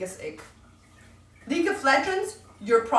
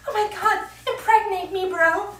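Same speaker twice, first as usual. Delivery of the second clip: high mock-fangirl swoon; put-on high girly voice; swooning girls; 8 semitones higher than usual. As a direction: squeal it like a smitten girl. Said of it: "they're like".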